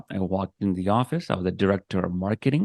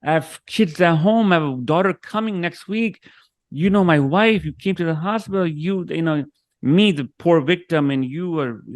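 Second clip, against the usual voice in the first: raised pitch, pleading and wounded; hurt plea; 8 semitones higher, 3 dB louder than usual. Retold or imitated. To the boss who fired him, a higher, hurt plea. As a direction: beg him like a betrayed friend.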